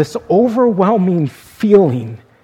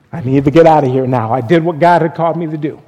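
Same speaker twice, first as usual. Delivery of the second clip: fast, rushed words; hurried escape; much faster than usual. Retold or imitated. Embarrassed man bolting for the door, fast and rushed. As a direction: rush it out, dying to leave.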